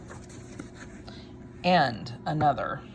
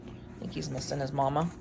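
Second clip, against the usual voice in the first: usual speaking voice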